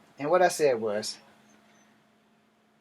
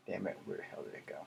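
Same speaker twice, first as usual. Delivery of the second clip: much softer and lower, half to oneself; muttered aside; 10 semitones lower, 16 dB quieter, faster than usual. Real, not acted. Vlogger hunting for a lost story, muttering. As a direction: grumble under your breath while searching.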